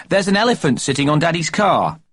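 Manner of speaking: put-on high, eager little boy; a small boy calling his mother; child's voice. A narrator's reading. Young Jeremy James calling to busy Mummy, high child voice.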